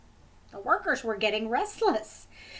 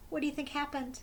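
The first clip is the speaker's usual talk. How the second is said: ordinary delivery, nothing marked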